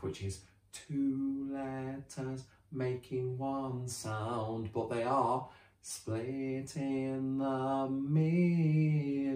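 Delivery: stretched very slowly, split and middle stressed; teacher summing up the lesson; slow, emphatic recap. Shown as a demonstration. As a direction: spell out the rule word by word. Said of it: "we've learned about split digraph".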